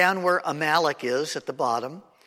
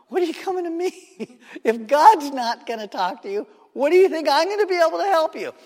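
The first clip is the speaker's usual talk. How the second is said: much higher, laughing, incredulous woman's voice; mocking medium; 13 semitones higher, 7 dB louder, faster than usual. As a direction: scoff at him through a laugh. Medium mocks Saul, laughing.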